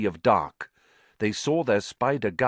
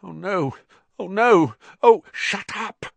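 higher and louder, panicking; rising panic, begging him to stop; frantic panic; 11 semitones higher, 6 dB louder than usual. A narrator's reading. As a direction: Ron panics in the dark and Harry silences him. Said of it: "said Harry frantically".

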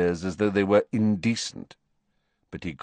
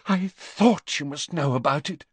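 higher, easing Narcissa voice; relieved Narcissa; 7 semitones higher than usual. Acted. Narcissa relieved that Snape already knows, higher. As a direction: breathe out with relief.

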